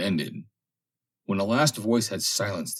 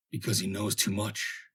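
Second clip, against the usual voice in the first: hoarse whisper; 3 semitones higher, 5 dB quieter than usual. A narrator's reading. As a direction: rasp it out under the breath. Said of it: "came a hoarse whisper".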